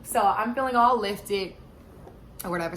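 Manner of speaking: ordinary talk, nothing marked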